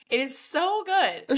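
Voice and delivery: gushing through a laugh, slowed; laughing, so delighted with the scene. Real, not acted. Excited fan laughing about the vacuum scene.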